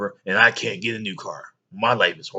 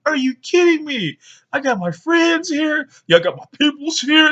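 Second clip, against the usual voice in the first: put-on much higher, louder pleading kid; 5 dB louder than usual. Boy begging his mom in front of friends, high pleading. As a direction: beg not to be embarrassed in front of friends. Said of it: "And I'm like".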